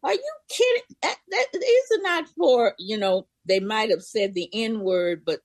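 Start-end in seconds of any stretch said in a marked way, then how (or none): 0.0-2.7 high, disbelieving voice of the job office. Retold or imitated. Imitating the clerk turning her away, high and incredulous. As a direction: mimic their shocked disbelief, pitched up. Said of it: "they were like".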